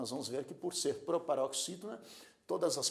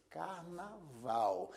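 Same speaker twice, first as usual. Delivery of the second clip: "Carnaval" slow, syllables apart, last one stressed; stress on VAL; 4 dB quieter, much slower than usual. Shown as a demonstration. Teacher showing the strong syllable, "Carnaval" stressed on val. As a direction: split car-na-VAL and hold "val". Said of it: "a sílaba val é a sílaba que se pronuncia com mais força".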